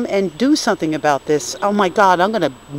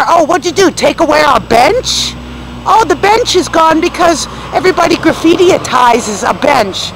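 indignant loud complaint; 6 semitones higher, 10 dB louder than usual. Real, not acted.

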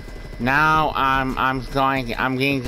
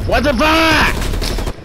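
shocked yelp; 12 semitones higher, much slower than usual. Real, not acted. Sudden in-game shock.

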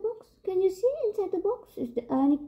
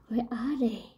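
ordinary delivery, nothing marked

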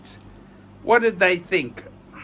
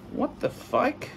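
ordinary talk, nothing marked

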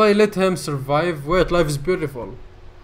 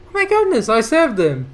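much higher, louder joyful cry; thrilled relief; 7 semitones higher, 6 dB louder than usual. Real, not acted. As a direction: burst out in happy disbelief. Rescued the suicidal character.

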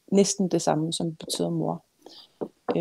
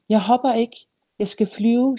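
raised, higher retort; defiant child; 5 semitones higher, 4 dB louder than usual. A narrator's reading. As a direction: call back insistently to grandmother. Child calls back from the window.

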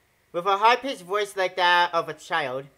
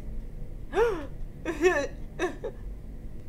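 sharp gasp; 9 semitones higher, 5 dB quieter than usual. Acted. Shocked discovery in game.

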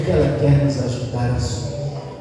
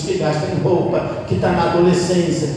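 ordinary talk, nothing marked

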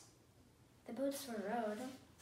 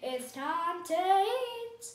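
loud, long-stretched dinner call; slow, high, carrying call; 4 semitones higher, 8 dB louder, much slower than usual. A narrator's reading. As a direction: holler everyone in to supper, drawing out the words. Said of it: "called Amelia Bedelia".

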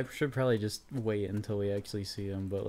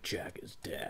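hushed, whispered mimicry; brooding game-character imitation; 13 dB quieter, slower than usual. Imitating the game character's grave line, hushed whisper. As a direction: whisper it low, under the breath. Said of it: "He's like".

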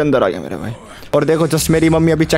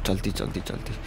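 much softer hurried whisper; urgent whisper; 10 semitones lower, 15 dB quieter than usual. Real, not acted. Gamer hurrying before dad notices, urgent whisper. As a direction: whisper it fast, sneaking.

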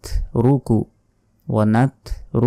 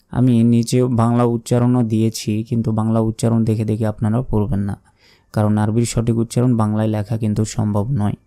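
normal talking voice

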